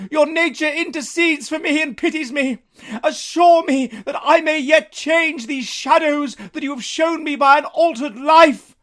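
high, slow, earnest pleading; Scrooge's earnest plea. Acted. Scrooge asking the spirit to let him change, pleading. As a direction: beg for a second chance.

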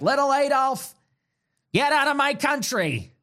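high-pitched mocking impersonation of a scolding pundit; high, hammed-up pundit imitation; Mark Levin. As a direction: push it up high, mimicking his indignation. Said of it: "he kinda sounds like that".

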